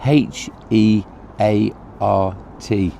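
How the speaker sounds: slow letter-by-letter spelling of "H E A R T"; spelled out slowly. Shown as a demonstration. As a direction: poet spelling the word heart for pupils, each letter slow and distinct. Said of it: "and how you spell heart".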